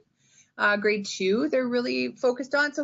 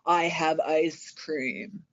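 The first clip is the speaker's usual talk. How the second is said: low, slow, sly evil voice; scheming like a little devil over ice cream; devilish whisper; 8 semitones lower, much slower than usual. Acted. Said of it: "looking a little devilish and evil".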